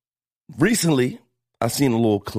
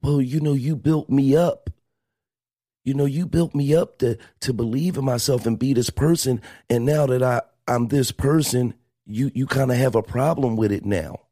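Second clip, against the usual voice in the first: higher voice imitating the wife; imitating Kim; 5 semitones higher than usual. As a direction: play her pushing back, voice raised. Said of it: "she kinda rebuttal".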